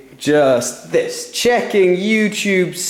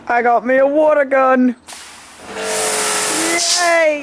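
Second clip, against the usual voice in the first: excited; 8 semitones higher than usual. Real, not acted. Excited about new water gun.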